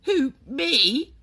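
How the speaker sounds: put-on a boy caught off guard; puzzled boy. A narrator's reading. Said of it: "looking genuinely puzzled".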